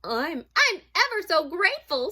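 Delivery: high, loud, flustered mouse; hurried voice. Acted. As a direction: stammer out hasty thanks, eager to leave. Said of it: "said Mouse in a hurried voice".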